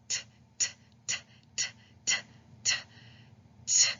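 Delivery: short unvoiced taps, t t t; tapping T sound. Shown as a demonstration. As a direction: tap the tongue, t. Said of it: "That's what your mouth should be doing".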